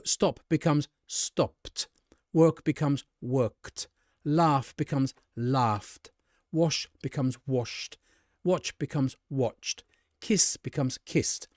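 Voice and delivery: slow drill, "stopped", "worked", "kissed" each ending in a crisp t. Shown as a demonstration. Teacher showing each word becomes one ending in t, slowly drilled. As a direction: stretch each pair, ending "washed" and "watched" on a voiceless t. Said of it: "We pronounce the E D t".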